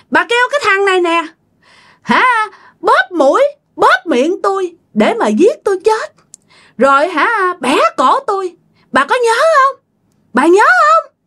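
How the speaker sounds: high, piercing accusation; shrill spirit voice. Acted. Spirit accuses grandmother of having it killed, shrill. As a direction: demand that she remember, pitch held way up. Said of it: "bà nói với cái giọng the thé nha".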